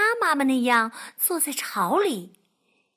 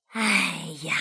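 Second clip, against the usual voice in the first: low, resigned sigh; fox sigh; 9 semitones lower than usual. Acted. Fox sighing at the hungry duckling. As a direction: sigh it out heavily. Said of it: "狐狸叹着气".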